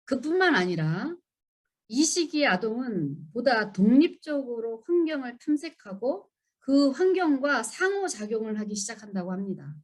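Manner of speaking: ordinary talk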